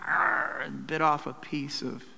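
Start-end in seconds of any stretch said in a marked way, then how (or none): 0.1-0.6 breathy mock animal growl; unvoiced growl like biting. Preacher imitating Tyson biting the ear, breathy growl. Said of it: "he went".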